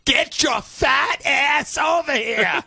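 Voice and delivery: wife hollering at her husband across the house; mock wife yelling. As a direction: yell at him across the room. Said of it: "him and his wife turned on each other".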